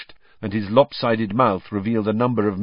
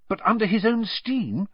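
eager question, pitched higher; 11 semitones higher than usual. A narrator's reading. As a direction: lean in keenly with the question. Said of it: "said Hermione eagerly".

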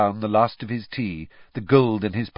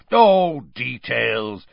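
loud high "oh", then dropping; dismissive; 3 semitones higher, 6 dB louder, much slower than usual. A narrator's reading. Bagman brushing off Crouch's caution, dismissively. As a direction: wave the word away. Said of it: "waving the word away like a cloud of midges".

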